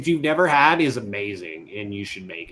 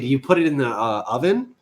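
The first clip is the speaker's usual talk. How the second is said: usual speaking voice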